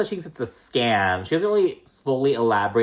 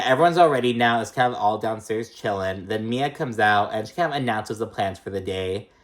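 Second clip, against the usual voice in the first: ordinary talk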